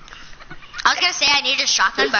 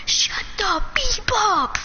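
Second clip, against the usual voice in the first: high, slow, drawn-out quote; imitating the brother; 7 semitones higher, much slower than usual. Friend quotes the brother's words back, high drawn-out mimicry. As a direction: mimic the outburst, stretching each word. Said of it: "when he said".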